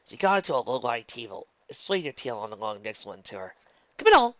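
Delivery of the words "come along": high, loud beckoning; brisk call. Acted. Rarity calling Luna onward, briskly. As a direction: call it out to hurry her.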